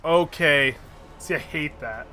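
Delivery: ordinary talk, nothing marked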